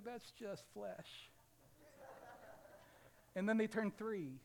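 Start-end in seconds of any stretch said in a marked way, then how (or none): 0.0-1.3 slow, soft deadpan; flat verdict. Retold or imitated. Preacher quietly and slowly judges the infant. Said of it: "I say".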